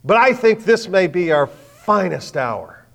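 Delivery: loud, high and slow, right in their face; a flight director confronting politicians loudly; forceful confrontation. Retold or imitated. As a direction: stand up to the senators with full force. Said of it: "gets in their face and says".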